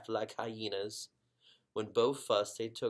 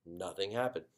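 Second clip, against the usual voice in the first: usual speaking voice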